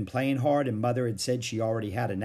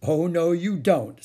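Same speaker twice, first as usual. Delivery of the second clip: slow, loud, higher refusal; firm refusal; 6 semitones higher, 6 dB louder, slower than usual. A narrator's reading. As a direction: shut her down firmly. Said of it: "said Beezus".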